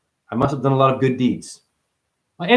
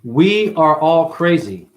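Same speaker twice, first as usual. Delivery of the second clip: slow declaration; 4 dB louder, much slower than usual. Acted. Slow pronouncement.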